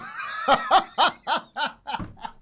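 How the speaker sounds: real high cackle; bursting out laughing. Real, not acted. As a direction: crack up at your own joke. Cracks up at own bit.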